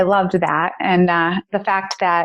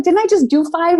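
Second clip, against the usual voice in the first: high, disbelieving squeak; can't believe it's five more; incredulous; 9 semitones higher than usual. Retold or imitated. Disbelief at extra miles.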